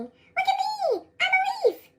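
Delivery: high, slow show-off cry; proud delight. Acted. Lance showing off to class, high and proud. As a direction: beam about being a leaf. Proud new leaf shows off.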